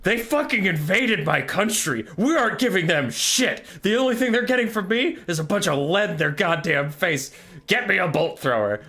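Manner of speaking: high, loud furious king bellowing orders; a king enraged at an invasion, yelling high and loud; furious roar. Retold or imitated. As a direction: slam the table and roar it. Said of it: "Malekith slams his hand down".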